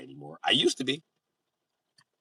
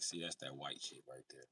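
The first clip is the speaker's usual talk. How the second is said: ordinary talk, nothing marked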